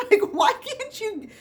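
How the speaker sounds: high, loud, drawn-out mock wail; exasperated plea. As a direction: wail it in comic frustration. Mock outburst at patient ends.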